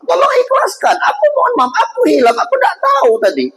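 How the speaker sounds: suara tinggi memelas, memohon-mohon. Acted. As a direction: merengek minta dimaafkan. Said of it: "Dia nyembah-nyembah kalau bahasa kita". (pemuda menyembah-nyembah minta diikhlaskan)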